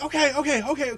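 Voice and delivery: high, loud flustered okays; flustered agreeing. Retold or imitated. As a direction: gabble it out flustered. Jojo flustered, hurrying away.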